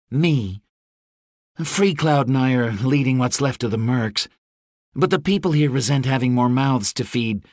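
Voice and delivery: a lightly voiced daughter, anxious; Rose. A narrator's reading. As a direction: Rose telling her father the situation is grim.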